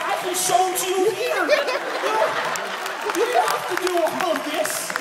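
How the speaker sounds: hammed-up panic at girlfriend; exasperated shouting. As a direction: yell back at girlfriend in disbelief. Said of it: "I was like".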